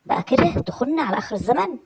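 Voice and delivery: high, loud, swooping outrage; indignant accusation. Acted. Wife alone at night accusing her absent husband of cheating, indignant. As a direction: confront him, pitch leaping up in indignation.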